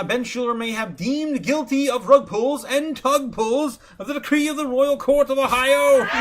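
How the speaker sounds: mock royal proclamation. Reading a viewer's super chat aloud as a royal herald. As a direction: proclaim it like a court crier.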